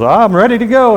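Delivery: a dressed-up rich man bragging he's ready; eager. Acted. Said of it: "And he goes".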